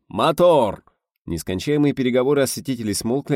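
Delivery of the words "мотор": «Мотор!» barked loud and high with a falling end; shouted command. A narrator's reading. Director shouting «Мотор!» to start the take. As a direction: call «Мотор!» across the studio. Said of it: "закричал Гайдай".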